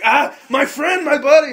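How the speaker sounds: slow, loud, high and hammy pal greeting; mock buddy-buddy. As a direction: greet the guard like an old friend. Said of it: "And I'm just like".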